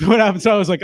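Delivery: ordinary talk, nothing marked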